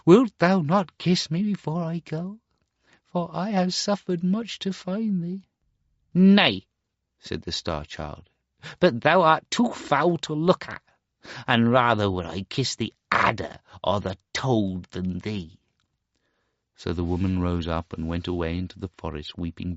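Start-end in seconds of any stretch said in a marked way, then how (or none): 0.0-5.4 much higher, tender plea; pleading mother. Acted. Mother begs her son for a kiss, pleading. 6.1-15.6 disgusted refusal. Acted. Star Child refuses his mother's kiss, disgusted.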